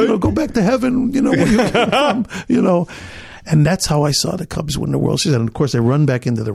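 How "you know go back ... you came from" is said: high, laughing send-off; thanking the fan through laughter. Retold or imitated.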